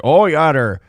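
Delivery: doing a Minnesota accent to mock the guy talking. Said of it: "this is the accent there".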